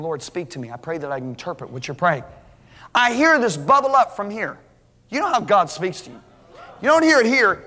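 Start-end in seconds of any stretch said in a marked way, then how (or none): 0.0-2.2 hushed prayer. Retold or imitated. Retold quiet prayer in tongues.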